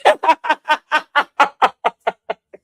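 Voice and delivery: high, loud burst of laughter; real helpless laughter; big laugh. Real, not acted. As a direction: crack up at your own joke. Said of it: "I'm crying".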